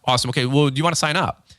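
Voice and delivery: rapid-fire sales patter, barely pausing; hurried contractor pitch that races past the listener; talking too fast. Acted. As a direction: rattle through the details in a run-on stream. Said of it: "you think talking fast is talking better".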